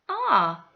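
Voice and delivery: R said as a long open ar; ar sound. Shown as a demonstration. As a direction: hold the ar sound. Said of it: "the R sound".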